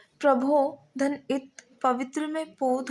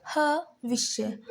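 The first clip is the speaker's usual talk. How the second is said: हविष्य drawn out slowly, each syllable clear; much slower than usual. Shown as a demonstration. Teacher sounding out हविष्य slowly for the students. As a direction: spell हविष्य out slowly for the class. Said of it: "अब यहाँ हम देखते हैं".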